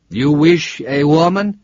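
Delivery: put-on slow, lightly Italian-accented question, "wish" lifted; a hint of an Italian driver asking "You wish a woman?" slowly; "You wish a woman?" with a light Italian lilt, rising at the end; Italian cabbie. Italian driver repeats request.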